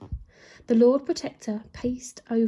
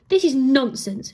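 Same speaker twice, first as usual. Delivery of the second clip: protesting queen; 3 semitones higher, 7 dB louder than usual. A narrator's reading. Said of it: "she protested".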